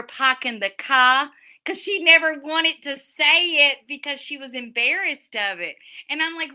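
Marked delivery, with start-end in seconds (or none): 0.0-1.3 put-on Boston accent with dropped R's; broad Boston "pahking the cah". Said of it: "I love that accent".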